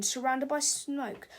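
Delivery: ordinary delivery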